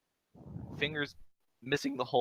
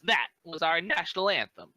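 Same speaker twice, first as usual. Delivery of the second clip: huffy goblin retort, pitched higher; mildly indignant, higher-pitched delivery; 7 semitones higher, 3 dB louder than usual. Acted. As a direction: huff it out in character, voice raised in pitch. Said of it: "Huffs and goes".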